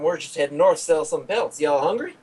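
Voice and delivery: easygoing Southern giant, hint of accent. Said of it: "In a southern giant accent".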